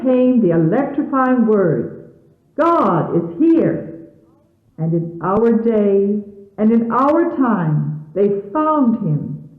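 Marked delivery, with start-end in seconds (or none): none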